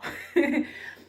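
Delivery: rire bref et soufflé, sans voix; lâcher un petit rire amusé. Real, not acted. Rire après sa blague. (petit rire soufflé après une blague)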